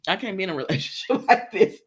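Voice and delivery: quick words broken by a laugh; real chuckle mid-sentence; amused laughing. Real, not acted. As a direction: laugh through the protest. Laughing at the story.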